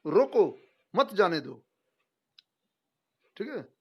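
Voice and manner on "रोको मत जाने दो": loud falling रोको, then मत जाने दो in one go; pause after रोको. Shown as a demonstration. Teacher repeats the punctuation demo, firm break after रोको. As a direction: set रोको apart as an order. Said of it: "विराम चिन्हों का कमाल देखिए".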